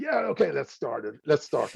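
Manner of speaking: a show host kicking off in put-on American English; mock eager start. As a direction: launch into it with fake breezy confidence. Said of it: "when somebody suddenly".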